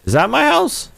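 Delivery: high rising disbelieving question; surprised question. Real, not acted. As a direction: pitch way up on house. Unsure if the house is his.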